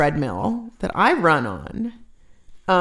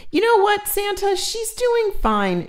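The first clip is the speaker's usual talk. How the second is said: high cheerful reply; 13 semitones higher than usual. Real, not acted. Answering viewer, high voice.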